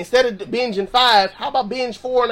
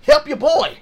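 higher-pitched, punchy call for help; acted urgent plea; 5 semitones higher, 3 dB louder than usual. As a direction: pray it straight to the point, pitched up. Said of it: "they got straight to the point".

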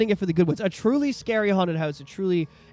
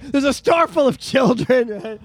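usual speaking voice

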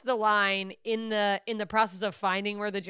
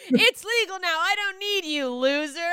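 mock taunt; 8 semitones higher, 4 dB louder than usual. Mock taunt to street dealer.

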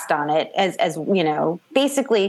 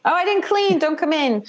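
higher-pitched mock protest at the door; flustered host; 9 semitones higher than usual. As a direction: playfully fend off a visitor, voice raised in pitch.

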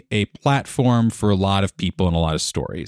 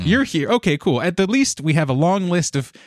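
higher, bright mock cheer; upbeat imitated greeting; 7 semitones higher than usual. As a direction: act out the eager hello, pitched up. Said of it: "be like".

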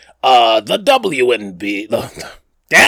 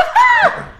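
short high burst of laughter at own sarcasm; 5 dB louder than usual. Real, not acted. Host laughing at his own sarcastic echo, loud high laugh. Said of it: "made it sound all sarcastic".

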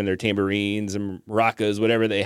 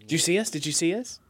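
piping, eager question; excited children; 9 semitones higher, 3 dB quieter than usual. Acted. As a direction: chirp it eagerly like kids running to parents. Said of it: "go running back excitedly to, like, tell".

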